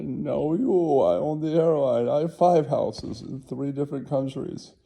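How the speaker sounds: one-up him in a high voice; hammed-up billionaire brag; bragging tycoon. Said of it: "He's like".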